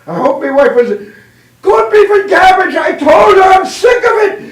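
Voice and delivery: hammed-up high Irish yell, building; Irishman at breaking point. Irishman sees corned beef before jumping, outraged high shout. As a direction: blow up in comic Irish outrage. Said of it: "the Irishman opens up, says".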